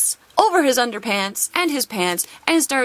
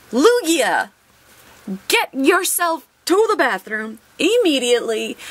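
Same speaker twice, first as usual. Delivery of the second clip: higher, firmer bossy-teacher order to the card; mock stern teacher; 6 semitones higher than usual. Pulls Lugia card, mock command.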